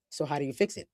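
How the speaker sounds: soft, lightly put-on podcast host voice. Host relaying Fred's question to listeners, in a hushed podcast voice. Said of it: "in my podcast voice".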